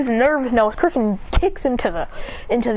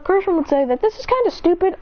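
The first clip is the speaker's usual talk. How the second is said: usual speaking voice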